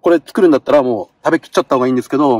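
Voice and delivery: normal talking voice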